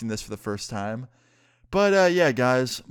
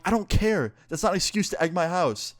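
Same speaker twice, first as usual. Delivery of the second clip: higher-pitched indignant pushback; exasperated retort; 7 semitones higher than usual. Retold or imitated. Retelling his answer to her apology about the eggs, exasperated. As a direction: cut the apology off, pitch jumping up.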